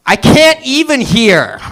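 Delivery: put-on exasperated New York girl; brash New York accent whine.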